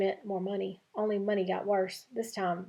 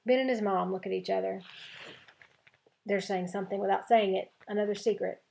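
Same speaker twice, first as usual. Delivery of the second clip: plain reading voice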